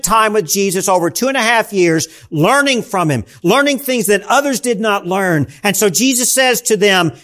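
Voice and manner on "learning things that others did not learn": ordinary talk, nothing marked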